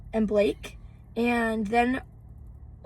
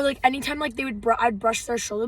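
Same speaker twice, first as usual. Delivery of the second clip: ordinary talk, nothing marked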